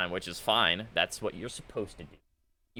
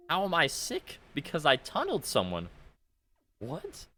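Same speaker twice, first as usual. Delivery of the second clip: pitched way up in disbelief; high incredulous; 7 semitones higher than usual. Real, not acted. Streamer called sick for tunneling, high incredulous. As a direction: squeak out the outrage.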